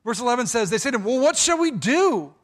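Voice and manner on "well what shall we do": higher, louder panicked plea; panicked sailors. Acted. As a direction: beg for answers as the storm worsens.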